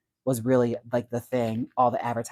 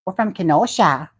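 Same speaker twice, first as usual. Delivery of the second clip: higher, louder Kenosha accent; Kenosha accent demo; 5 semitones higher, 5 dB louder than usual. Shown as a demonstration. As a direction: show off a Midwestern Kenosha accent. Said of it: "Is it more like Drop Dead Gorgeous?".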